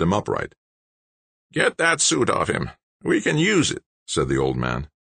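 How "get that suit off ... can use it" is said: put-on high voice like an old diesel rig; grinding rasp. A narrator's reading. Old man ordering the suit taken, grinding rasp. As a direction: grind the order out like worn gears. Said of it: "His voice sounded like an old-time diesel rig".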